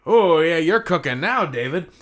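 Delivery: loud, high cheer to himself; excited self-praise. Real, not acted. Writer cheering his own new idea, loud and high. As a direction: pump yourself up, shouting. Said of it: "cheering himself on loudly".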